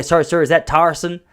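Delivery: mock intense server asking the name; imitating an intense American barista; intense question. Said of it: "what's with the intensity?".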